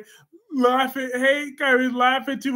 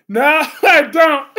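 loud, high no broken by a laugh; laughing refusal; 5 semitones higher, 10 dB louder than usual. Real, not acted. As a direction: shout it, cracking up.

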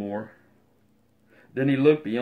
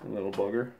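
usual speaking voice

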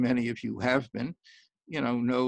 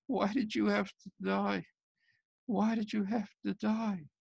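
crying widow; 8 semitones higher than usual. Acted. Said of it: "crying and crying".

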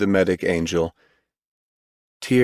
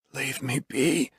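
very slow, soft and higher; whispered reply; 6 semitones higher, 7 dB quieter, slower than usual. A narrator's reading. Injured man pushing the surgeon away, whispered. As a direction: draw it out in a weak near-whisper. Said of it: "was Bob's whispered reply".

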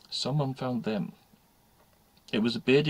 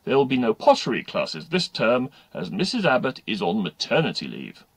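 loud, formal school announcement; loudspeaker announcer; 7 dB louder than usual. Acted. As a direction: read out notices to the whole school. Reads school announcement over loudspeaker.

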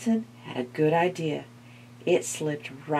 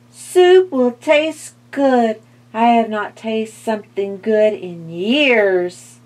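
skeleton voice; 4 semitones higher, 8 dB louder than usual. A narrator's reading. Skeleton voice, savouring.

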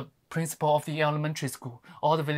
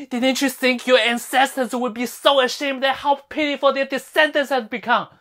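loud, raised-pitch scolding; indignant rebuke; 9 semitones higher, 8 dB louder than usual. Acted. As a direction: shame them at high volume. Berates villagers over lost pride.

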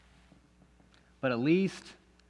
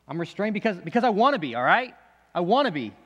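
rising to a loud, higher defensive snap; defensive outburst; 4 semitones higher, 5 dB louder, faster than usual. Acted. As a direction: insists hotly, voice climbing.

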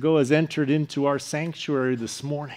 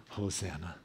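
hushed cry; 8 semitones lower, 15 dB quieter than usual. Acted.

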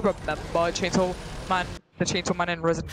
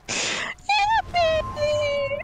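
very high, drawn-out squeal; over-the-top cheer. Pretend. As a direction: squeal it out in mock glee.

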